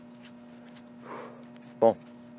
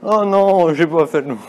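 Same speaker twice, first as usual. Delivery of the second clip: higher, louder disappointed cry; loud dismayed cry; 6 semitones higher, 7 dB louder than usual. Real, not acted. Misses third lap, dismayed.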